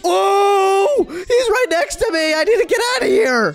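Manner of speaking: high terrified yells; panicked screaming. Real, not acted. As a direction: scream in real terror. Monster right next to her.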